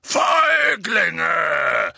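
long, loud, high bellow; roaring. A narrator's reading. Hagrid furious at his attackers, roaring. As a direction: roar it at the attackers. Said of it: "brüllte Hagrid".

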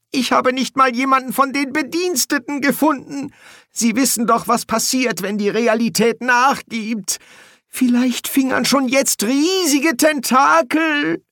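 very high, agitated voice; panicky whining. A narrator's reading. Bursar fears reality is giving way, whimpering in panic. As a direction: whine on with rising dread.

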